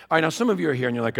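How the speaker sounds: ordinary talk, nothing marked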